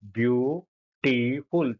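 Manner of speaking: Beautiful stretched slowly, syllable by syllable; slow syllable demo. Shown as a demonstration. Teacher counting syllables aloud, very slow. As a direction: pull beautiful apart slowly for the class. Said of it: "तीन syllable शब्दांश हुए ना बेटा".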